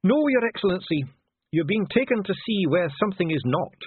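high-pitched formal correction; stiff dwarf official. A narrator's reading. Dwarf correcting the envoy's question, stiffly. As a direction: correct him pointedly in a high voice.

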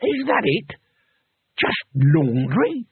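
slow, stretched-out furious question; enraged disbelief. A narrator's reading. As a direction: drag the last word out in rage. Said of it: "He was shaking with rage".

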